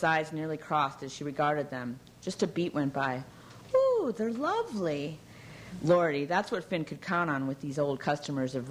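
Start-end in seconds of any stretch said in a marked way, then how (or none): 3.7-5.2 high, slow, drawn-out gushing Ooh; gushing society matron; mock delight. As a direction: overdo the polite praise. Customer feigns delight at quiches.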